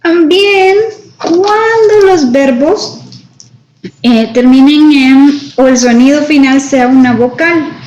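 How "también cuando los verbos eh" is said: ordinary talk, nothing marked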